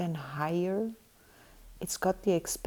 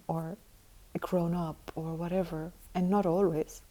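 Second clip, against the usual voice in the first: ordinary talk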